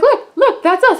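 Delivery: high, loud, insistent excitement; eager showing-off. Retold or imitated. Fan showing the actor a photo, eager. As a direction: pipe it up high, urging him to look.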